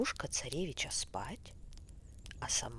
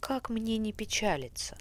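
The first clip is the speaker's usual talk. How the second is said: higher, louder prince voice; a troubled prince answering, higher and louder; prince, raised voice; sad prince; 7 semitones higher, 5 dB louder than usual. A narrator's reading. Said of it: "отвечает Иван-царевич".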